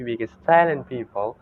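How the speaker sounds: ordinary talk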